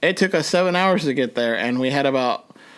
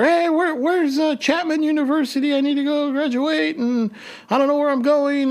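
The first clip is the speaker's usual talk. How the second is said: much higher, panicked calling out to a stranger; retold frantic yell; 12 semitones higher than usual. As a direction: shout for directions in a panic. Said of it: "was screaming at her".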